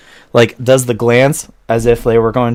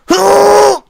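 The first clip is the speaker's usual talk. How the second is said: strained gasp; 7 dB louder than usual. Acted. Lifting a heavy keg.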